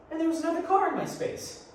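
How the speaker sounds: increasingly agitated, high and loud by the end; growing agitation. Acted. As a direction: get worked up, pitch climbing as the volume builds. Agitated over taken parking space.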